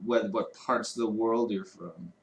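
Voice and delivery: ordinary talk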